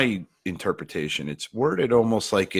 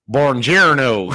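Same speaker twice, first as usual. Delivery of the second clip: slow, drawn-out Italian Buongiorno, a bit louder; mock Italian greeting; 3 semitones higher, 7 dB louder, much slower than usual. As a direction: stretch out Buongiorno like a clueless tourist. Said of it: "He goes".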